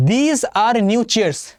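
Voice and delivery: every word drawn out very slowly; slow example read. Shown as a demonstration. Teacher reading an example sentence to the class, very slow. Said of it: "reads the example sentence".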